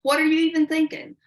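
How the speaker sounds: mock road-rage mom; mom yelling at driver. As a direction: blow up at the driver who cut her off. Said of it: "she said".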